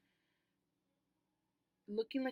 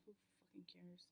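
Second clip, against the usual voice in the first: whispered aside; 21 dB quieter, faster than usual. Real, not acted. Muttered aside about saying it.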